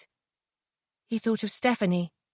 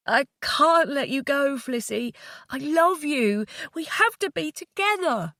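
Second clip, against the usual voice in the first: crying softly; 6 semitones higher than usual. Acted. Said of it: "He was crying, and his voice was oddly low and soft".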